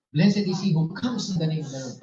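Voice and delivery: loud ringing proclamation; proclaimed loud. Acted. Pastor voicing the crowd's praise, loud. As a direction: call it out like the crowd at Jerusalem.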